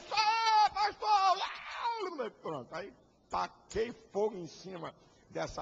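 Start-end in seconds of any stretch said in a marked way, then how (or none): 0.1-2.3 high, loud, drawn-out mock cheer; crowd cheering. Pastor imitating the crowd cheering him at the rally, high loud cheer. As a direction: shout it like a thrilled crowd.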